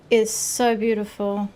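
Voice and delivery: ordinary talk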